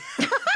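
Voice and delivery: high burst of laughter. Real, not acted. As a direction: laugh at the crude joke. Laughs after boner joke.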